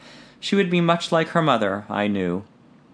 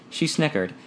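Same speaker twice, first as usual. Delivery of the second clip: ordinary delivery, nothing marked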